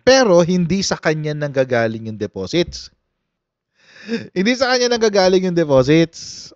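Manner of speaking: normal talking voice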